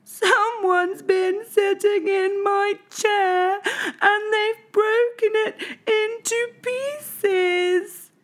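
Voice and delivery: very high, slow, tiny cub voice; Baby Bear crying out. A narrator's reading. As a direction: wail it like a heartbroken cub. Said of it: "cried Baby Bear".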